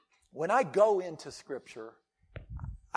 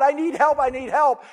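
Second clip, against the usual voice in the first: high, loud desperate plea; crying out; 8 semitones higher, 13 dB louder than usual. Retold or imitated. The mother begging Jesus for help, high loud plea. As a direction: beg for help, pitched up and raised. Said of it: "She's crying out".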